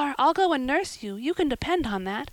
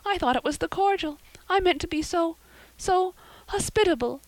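put-on distressed girl; 7 semitones higher than usual. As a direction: explain anxiously, nearly in tears. Anne voiced high.

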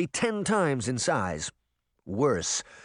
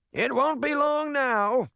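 put-on old man voice; 13 semitones higher, slower than usual. A narrator's reading. Tom voiced as character.

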